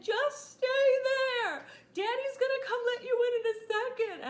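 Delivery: put-on calling to the baby through the screen door in a panic; panicked high call. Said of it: "I said".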